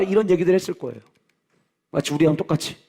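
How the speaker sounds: ordinary talk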